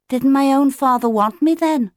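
put-on high, young, wondering question; questioning chick. A narrator's reading. Otto to Claudius about his real father, high childlike question. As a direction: pitch it up like a chick asking.

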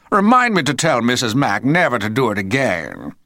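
a hint of a blustering dad; pompous father. A narrator's reading. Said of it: "said Mister Frightfully Busy".

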